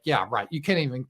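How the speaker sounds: ordinary talk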